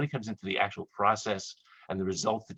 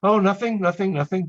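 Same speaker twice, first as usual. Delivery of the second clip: high, loud hurried covering-up; fake reassurance; 9 semitones higher, 7 dB louder than usual. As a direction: brush it off too quickly. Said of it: "I go".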